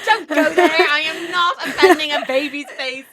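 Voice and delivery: high and loud, laughing; laughing protest. Real, not acted. Laughing protest about baby's face.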